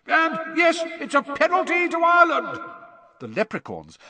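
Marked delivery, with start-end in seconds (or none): 0.1-2.6 very high, loud announcer's shout; excited, high-pitched commentator yell; Bagman commentating. A narrator's reading. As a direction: shout the verdict to the whole stadium. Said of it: "Bagman informed the roaring spectators".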